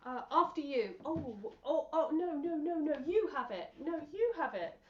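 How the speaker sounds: overly polite, insisting you go first; fussy polite "no, you have it"; polite English deference. Shown as a demonstration. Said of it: "we might just go like this".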